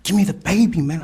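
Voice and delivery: high, loud insistent plea; urgent retold demand. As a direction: raise your voice, demanding the baby. Retold argument over baby.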